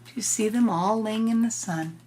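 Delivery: plain reading voice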